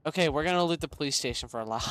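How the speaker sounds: ordinary talk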